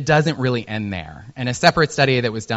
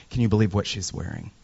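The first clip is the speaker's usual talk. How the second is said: catty gossip whisper; 5 semitones lower, 7 dB quieter, faster than usual. Retold or imitated. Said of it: "be like".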